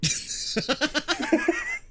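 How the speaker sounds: short high laugh; real laugh. Real, not acted.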